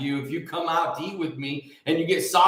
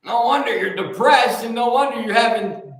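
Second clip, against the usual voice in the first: louder, higher exclamation; raised, punchy declaration; emphatic repeat; 4 semitones higher, 5 dB louder than usual. Real, not acted. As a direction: drive it home loudly. Repeats aside with force.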